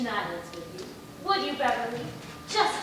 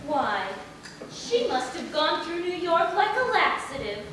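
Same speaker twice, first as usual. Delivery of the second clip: ordinary talk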